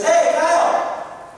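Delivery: loud, high call across the lot; retold dad calling out. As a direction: shout his son's name from the car window. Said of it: "He screams".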